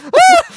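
loud, high mock scream; sudden shriek rounding a corner; Nardole's scream. Co-host acting Nardole turning the corner, mock scream. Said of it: "Nardole scream that really made me laugh".

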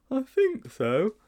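put-on high, slow, hesitant boy; tentative schoolboy resolve; steeling himself. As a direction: muster courage slowly in a high voice. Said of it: "steeling himself".

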